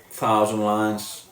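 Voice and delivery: ordinary talk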